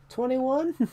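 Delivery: very high, clueless answer; dumb kid voice. Retold or imitated. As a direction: squeak it out like a dim kid. Meme answer redone.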